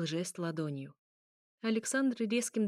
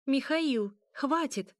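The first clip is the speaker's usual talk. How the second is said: higher, louder urgent call; shouted plea; 6 semitones higher, 4 dB louder, much slower than usual. A narrator's reading. Young princess appeals to Mikhail mid-fight, shouted plea. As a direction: call out to make him stop. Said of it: "словно кричала в пустоту".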